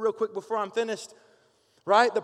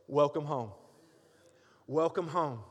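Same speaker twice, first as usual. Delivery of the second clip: softer, lower, gentle welcome; tender welcome; 6 semitones lower, 5 dB quieter than usual. Acted. God welcoming a lost son home, soft and tender. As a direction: open your arms with the words.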